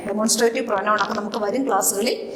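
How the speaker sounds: quiet aside. Real, not acted. Teacher repeats aside softly.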